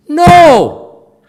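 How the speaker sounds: high, very loud "no"; emphatic shout. Real, not acted. Teacher rejecting Confucius's idea of improper, emphatic shout. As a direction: burst out in a shouted refusal.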